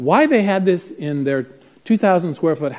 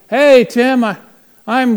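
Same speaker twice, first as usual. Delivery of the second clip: loud, higher voice of a man hailing; calling out; 9 semitones higher, 9 dB louder, slower than usual. Retold or imitated. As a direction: call out from behind a wall. Man calls from behind wall.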